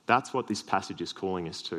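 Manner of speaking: ordinary delivery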